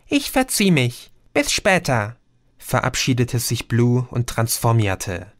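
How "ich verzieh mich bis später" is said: high, puzzled little being; tiny creature voice. A narrator's reading. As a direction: squeak it like a tiny fairy, puzzled by the word. Creature says goodbye. (hohe, verwunderte Wesen-Stimme)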